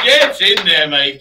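loud celebratory yell; cheering shout. Real, not acted. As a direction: shout it out, thrilled.

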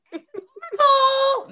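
high, drawn-out playful shout; Crystal. Retold or imitated. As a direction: belt it out as a teasing high-pitched impression. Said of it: "In my crystal's voice".

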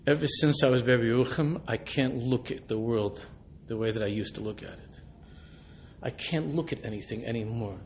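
put-on low, soft, intense retelling of an old man; quiet old man's awed confession; hushed intense confession. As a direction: lean in and murmur it like a life secret. Old man shaking him, confiding.

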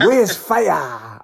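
put-on drawn-out Nigerian-accented catchphrase; imitated Nigerian fan. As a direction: stretch the praise in a Nigerian accent. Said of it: "fantastic Nigerian guy, full of life".

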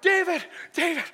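high, loud winded call. Acted. Soldiers hail their king after a run, calling out. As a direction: call out as if running up with news. Acted men running back with water.

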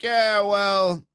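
loud, high, drawn-out mock reply; unpretentious mock quote. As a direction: belt out a casual caricature of his answer. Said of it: "that's not how he said it".

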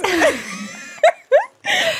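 high shrieking laugh. Real, not acted. Laughing at the funny card.

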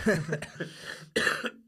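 hard coughing fit. Real, not acted. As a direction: cough through it, real. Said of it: "I'm having a bit of a coughing fit here".

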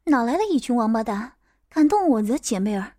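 ordinary delivery, nothing marked

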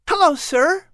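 put-on high, eager little boy pleading; a hopeful child asking his mother; boy begging to keep his pets; young boy voice. Patrick greets courier.

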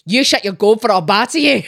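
Scottish scolding with a laugh; Scottish accent. Retold or imitated. Imitating what she heard as a child, Scottish accent. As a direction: scold in broad Scots.

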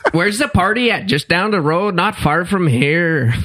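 lightly hammed-up Mennonite accent greeting a visitor. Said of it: "They ta-, they talk really loud".

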